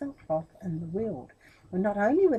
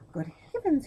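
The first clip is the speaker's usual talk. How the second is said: shocked; 8 semitones higher than usual. Acted. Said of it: "he thought when he looked".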